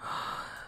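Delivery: sharp frightened gasp; startled gasp. Real, not acted. Jump scare in horror game.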